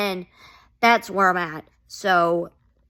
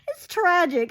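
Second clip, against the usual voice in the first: laughing sob; 3 dB quieter than usual. Real, not acted. Fan gushing over sad storyline.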